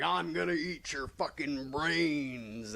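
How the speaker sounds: slow, menacing monster threat; menacing Venom. Retold or imitated. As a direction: drag the threat out like a hungry monster. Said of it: "And fucking he's like".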